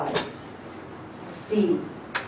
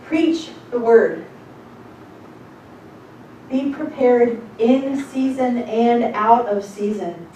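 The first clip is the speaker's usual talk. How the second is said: preaching; 3 semitones higher, 5 dB louder, slower than usual. Acted. Preaching the scripture slowly.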